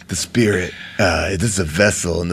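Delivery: mock guru, low and hushed; Seagal impression. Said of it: "He goes".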